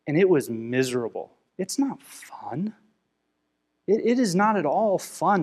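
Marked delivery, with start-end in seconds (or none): 1.6-2.7 slow, hushed whisper. Real, not acted. Pastor confessing his bitterness to the congregation, whispering. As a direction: let it slip out as a whisper.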